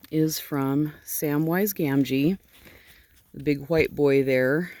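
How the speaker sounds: ordinary talk, nothing marked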